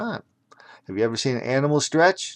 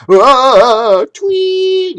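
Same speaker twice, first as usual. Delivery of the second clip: high loud whistle tweet; shrill tweet like a ref's whistle; whistle sound; 12 dB louder than usual. Acted. Narrator imitates the ref's red whistle, high loud tweet.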